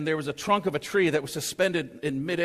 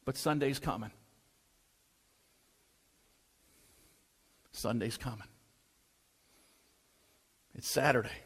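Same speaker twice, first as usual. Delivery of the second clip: quiet refrain; 4 semitones lower, 7 dB quieter than usual. Real, not acted.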